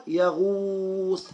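يغوص with a long drawn-out oo; teacher's repeat-after-me يغوص, vowel stretched; lengthened damma. Shown as a demonstration. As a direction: hold the oo in يغوص steadily. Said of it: "هنطول في الضمة".

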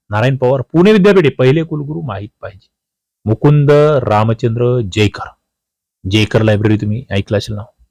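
usual speaking voice

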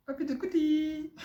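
high-pitched coochy-coo; playful squeaky babying; baby talk. Pretend. Nonsense baby talk to teased crybaby.

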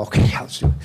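breathy whispered plotting between little brothers; giggling whisper. Retold or imitated. Little brother whispers to big brother before breakfast, giggling whisper. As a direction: whisper it like a kid sharing a naughty secret. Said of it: "And they giggle".

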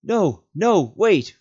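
loud, high urgent call; calling back. Acted. Frodo calling up to stop Sam climbing down, urgent. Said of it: "Frodo called back".

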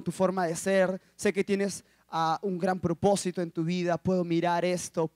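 low, soft, careful praise; gentle compliment. Retold or imitated. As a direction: cushion the blow softly. Voices the girl's kind speech.

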